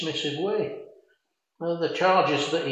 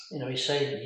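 ordinary delivery

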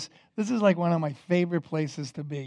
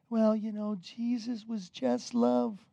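slow, slightly raised naive voice; mocking imitation; 4 semitones higher, slower than usual. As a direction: mimic a dreamy, naive objector. Mimics naive objectors he disagrees with.

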